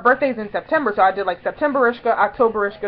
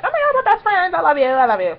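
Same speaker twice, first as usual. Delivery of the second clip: high, excited squeal; gushing; 8 semitones higher, 4 dB louder than usual. Retold or imitated. Two new friends gushing to each other, high squeal.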